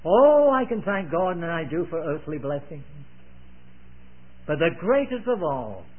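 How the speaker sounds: ordinary talk, nothing marked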